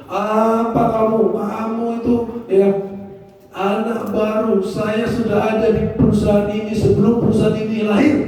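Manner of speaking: hammed-up boss snubbing a junior's idea; mock arrogant boss. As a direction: lord it over the new hire. Arrogant boss dismisses new employee.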